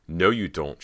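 loud, sharp refusal; sharp reply. A narrator's reading. Cody refuses Bo's order to leave, sharp reply. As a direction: cut him off sharply. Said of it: "she replied sharply".

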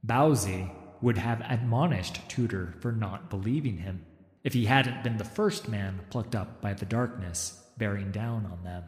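ordinary delivery, nothing marked

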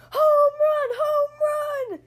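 high joyful shout. Acted. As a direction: yell it out with joy. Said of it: "shouted Froggy".

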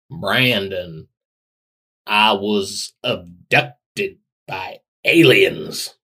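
very slow, drawn-out Southern Jack Nicholson. Retold or imitated. Second try at [name] as Southern Jack Nicholson, very slow Southern. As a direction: stretch every word in a Southern Jack Nicholson voice. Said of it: "do Jack Nicholson and then Southern voice".

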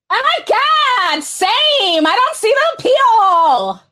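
put-on high, bubbly Valley Girl read. Streamer reading a chat message as a Valley Girl. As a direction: squeal it like a mall girl. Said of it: "I got my Valley Girl voice on".

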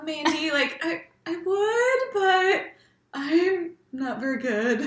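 high, slow, halting impression; stretched-out high voice, breaking into a laugh; Crystal. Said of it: "That was my impression".

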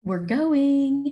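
high, loud, gleeful announcement; excited cheer. Real, not acted. Fan telling co-host they'll be at the game, excited. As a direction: sing it out with excitement.

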